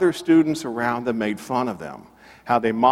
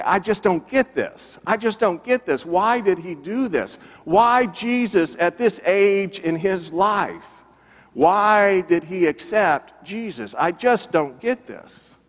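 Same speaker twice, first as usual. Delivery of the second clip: higher-pitched, exasperated bewilderment, retold; baffled disbelief; 6 semitones higher than usual. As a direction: act out a man who just can't understand it. [name] acted pacing, baffled by conversion.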